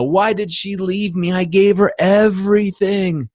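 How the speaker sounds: high, slow, put-on whining; mock a self-pitying guy who doesn't get it; hammed-up wounded whine; whiny dumped man. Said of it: "When they say".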